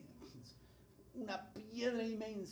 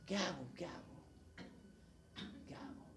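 acted helplessness, fading softer; helpless muttering; 2 semitones lower, 13 dB quieter than usual. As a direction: let it trail off into a quiet, helpless plea. Acting out someone in a dilemma.

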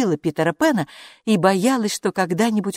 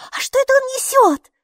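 put-on very high, louder child voice; curious little girl; 5 dB louder than usual. A narrator's reading. Maiki spots the creature carrying something, high curious voice. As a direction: ask like an excited small child, pitched way up. Amazed small girl keeps asking.

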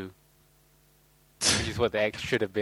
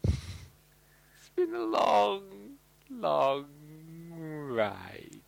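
drawn-out weary sigh; 3 semitones higher, 4 dB quieter, much slower than usual. Acted. Mock weary closing line.

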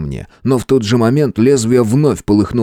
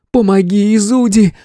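high, urgent female cry; calling for help; 11 semitones higher than usual. A narrator's reading. Kitsune calls the hero to help, urgent cry. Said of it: "Кицуни звала на помощь".

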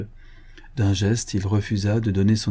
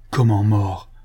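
shocked exclamation; 4 dB louder, slower than usual. A narrator's reading. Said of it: "s'écria-t-on".

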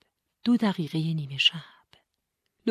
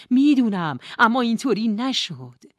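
louder and higher repeat; insistent I know; 6 semitones higher, 7 dB louder than usual. Acted. Nora admits, then insists.

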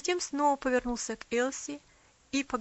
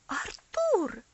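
shocked exclamation; 9 semitones higher, 6 dB louder than usual. A narrator's reading. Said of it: "воскликнула она".

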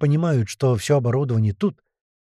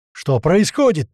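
loud, higher urgent question; yelling; 5 semitones higher, 5 dB louder, slower than usual. A narrator's reading. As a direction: demand answers over the commotion. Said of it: "заорал Гера". (громкий встревоженный вопрос)

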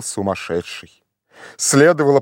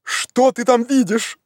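high, drawn-out question; eager raised questioning; delighted shout; 9 semitones higher than usual. A narrator's reading. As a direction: call up eagerly, very pleased. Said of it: "очень довольный".

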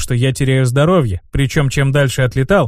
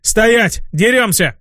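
shouted order; 7 semitones higher, slower than usual. Acted. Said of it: "заорал я".

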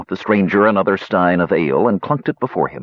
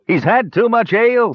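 high-pitched teasing dwarf; smirking jibe; 13 semitones higher, 3 dB louder than usual. A narrator's reading. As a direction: tease with an audible smirk. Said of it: "suggested the dwarf with a smirk".

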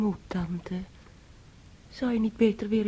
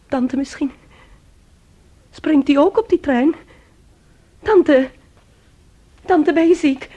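alarmed; 5 semitones higher, 9 dB louder than usual. Acted. Niece alarmed at the aunt.

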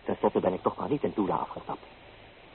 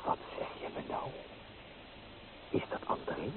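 a soft, breathless whisper of disbelief; stunned whisper; 4 semitones lower, 12 dB quieter than usual. Acted. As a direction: say it as if the news knocks the air out of you. Shocked by her fiancé news.

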